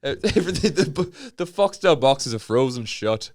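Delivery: loud, hammed-up Fargo accent. Northerner blames the only film on cable, Fargo accent. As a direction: lean hard into Fargo vowels. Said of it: "talk like Francis McDormand from Fargo".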